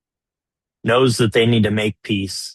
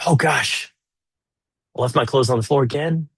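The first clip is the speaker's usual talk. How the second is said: mock sheepish; 2 semitones higher than usual. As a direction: admit it with a groan. Husband voiced, caught out.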